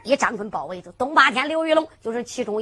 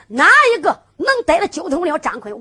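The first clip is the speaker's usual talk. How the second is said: raised, loud rallying the troops; rallying call; 4 semitones higher, 7 dB louder than usual. Acted. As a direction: call out to the whole band. Chief rallies his men. (高声号令众人)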